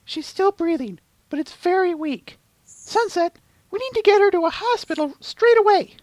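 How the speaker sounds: very high-pitched urgent character voice; urgent Twilight Sparkle. Acted. Urging Sunset to get Luna to a hospital, high and urgent. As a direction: play a worried heroine, pitched far above your own.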